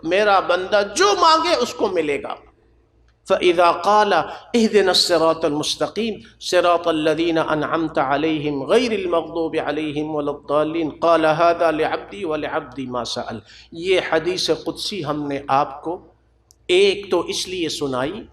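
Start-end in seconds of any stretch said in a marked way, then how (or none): 3.3-13.4 quick, low-key recitation of the Arabic text; rapid Arabic recitation. Acted. Preacher reciting the hadith text to the congregation, brisk recitation. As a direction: rattle off the Arabic verses at a brisk pace.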